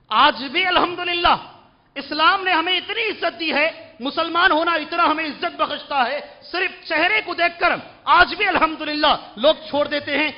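full-force sermon; preaching at full force. Acted. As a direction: preach it proudly to the congregation. Fervent preaching about honor.

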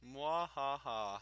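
slow evil villain laugh; mad scientist. Pretend. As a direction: cackle like a gloating villain. Gloating after flooding the boat.

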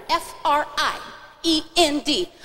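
"F R I E N D" letter by letter, gap after I; spaced-out spelling. Shown as a demonstration. Host shows kids how to spell friend, "F R I", gap, "E N D". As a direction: spell F R I, pause, then E N D. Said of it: "I left a little space in between there".

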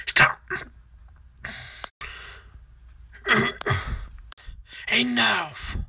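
playing at being furious; mock anger. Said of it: "I never see you that angry before".

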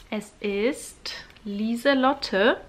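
slow, hushed reveal whisper; suspenseful whisper. Real, not acted. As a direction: whisper the name slowly, building suspense. Reveals drawn winner's name.

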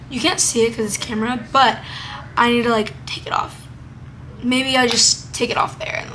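ordinary delivery

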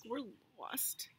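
a frightened whispered admission; scared whisper. Acted. Jack whispers to Alice that they are lost. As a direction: breathe it out, no voice, lost in the woods.

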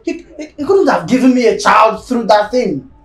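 loud, stammered start, then forceful; indignant outburst. Acted. Spouse ranting about a child from that woman, loud indignation. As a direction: shout it with indignation.

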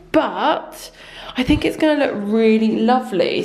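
ordinary talk, nothing marked

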